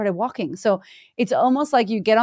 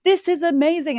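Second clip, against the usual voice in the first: mock delighted; 8 semitones higher than usual. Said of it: "and I'm like".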